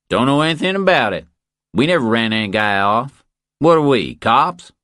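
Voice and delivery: Billy brushing off the question, loud and high. Acted.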